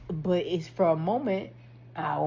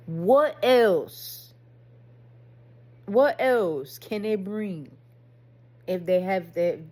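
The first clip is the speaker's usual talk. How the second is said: loud, stretched-out rhetorical question; raised-voice, insistent self-questioning; emphatic challenge; 8 dB louder, slower than usual. Retold or imitated. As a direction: press the question hard and slowly. Challenges himself in acted self-talk.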